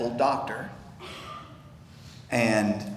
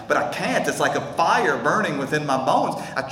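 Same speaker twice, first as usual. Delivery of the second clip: normal talking voice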